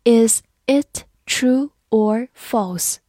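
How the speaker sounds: slow, deliberate read; slow classroom model question. Shown as a demonstration. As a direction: draw each word out for learners. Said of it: "好，慢慢来读。".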